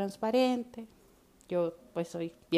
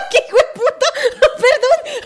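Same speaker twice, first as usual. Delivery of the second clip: much louder, high laughing curse; laughing outburst; 16 dB louder than usual. Real, not acted. Hearing her friend's ex called her a witch, laughing outburst. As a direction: explode with laughter and outrage.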